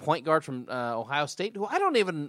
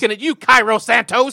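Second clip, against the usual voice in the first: pointed callout; 9 semitones higher, 13 dB louder than usual. Real, not acted. Calling out kicker.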